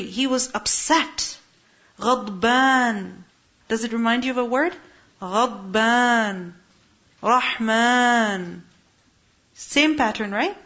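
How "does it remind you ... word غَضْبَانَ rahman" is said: ordinary talk, nothing marked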